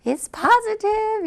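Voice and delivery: high, slow, sing-song announcement; excited. Retold or imitated. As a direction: stretch it out, thrilled to share the news. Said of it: "she's all excited".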